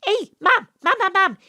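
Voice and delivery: high loud calling; eager child shout; excited child calling. Acted. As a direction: pester mum eagerly.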